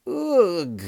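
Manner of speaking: high, loud "ugh" of revulsion; falling yelp of disgust; disgusted cry. A narrator's reading. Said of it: "she screamed".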